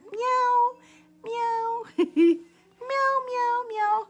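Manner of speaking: very high, playful cat mewing; kitty cat meows. Pretend. Host playing kitty cat for Bambi, high meows. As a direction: mew like a little kitty, giggling between. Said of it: "I'm a kitty cat".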